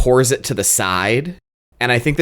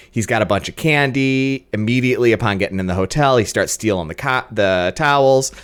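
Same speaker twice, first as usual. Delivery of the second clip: usual speaking voice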